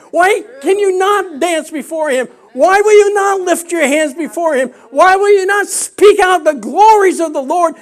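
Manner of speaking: loud, high, rising sermon cry; fervent pastor lifting the whole room; preaching at full force. Acted. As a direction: preach it out, building to a shout.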